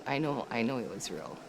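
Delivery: quietly convincing herself it was real; hushed, inward repeat; soft repeat. Real, not acted.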